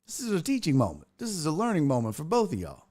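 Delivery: usual speaking voice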